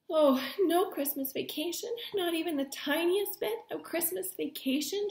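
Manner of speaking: high, whiny complaint; complaining. A narrator's reading. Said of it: "she complained".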